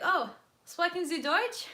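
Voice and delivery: high, slow, careful German question; high slow German to strangers; hopeful tourist German. Retold or imitated. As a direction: try German on them hopefully. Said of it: "And I was like".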